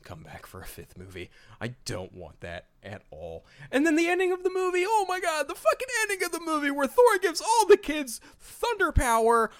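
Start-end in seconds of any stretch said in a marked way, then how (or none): 3.7-9.5 high-pitched, fast, incredulous outburst; worked-up high exclaiming; exasperated rant. Real, not acted. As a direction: rant in disbelief, voice shooting way up. Exasperated rant about the movie's ending.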